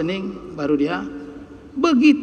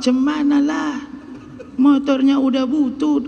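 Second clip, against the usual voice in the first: tinggi, mengeluh malas; keluhan pasrah; 5 semitones higher than usual. Retold or imitated. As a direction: keluhkan motor tua dengan pasrah. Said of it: "Kenapa motornya, Pak?". (jamaah mengeluh pasrah soal motor tua, nada tinggi)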